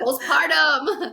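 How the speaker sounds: slow, loud, high, with real laughter; laughing exclamation. Real, not acted. Correcting her menopause slip to her co-host, laughing exclamation.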